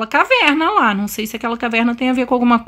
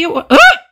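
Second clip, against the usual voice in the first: startled scream; 5 semitones higher, 7 dB louder than usual. Real, not acted. Monster appears, she screams.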